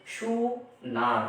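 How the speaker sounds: সুনার drawn out very slowly, syllable by syllable; সুনার split slowly into সু and নার; syllable stretching. Shown as a demonstration. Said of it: "কিভাবে মাত্রা গণনা করবো দেখেন".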